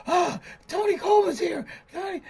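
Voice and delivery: high, thrilled mock welcome; imitating jammers excited to see him; excited greeting. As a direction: squeal the name like they're thrilled. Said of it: "they were all excited".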